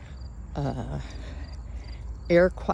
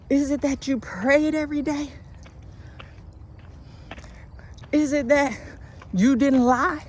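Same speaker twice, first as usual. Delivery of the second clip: rising, higher preacher cadence; preaching; 8 semitones higher than usual. Acted. Preaching build up.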